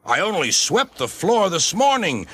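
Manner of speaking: grumbling. A narrator's reading. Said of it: "grumbled the conductor".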